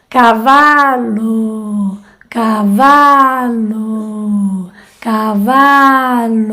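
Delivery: "caballo" said as "cavalo", very slow, weak inner u; Portuguese cavalo. Shown as a demonstration. Coach repeating the word for practice, very slow. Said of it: "Ahora voy a decir".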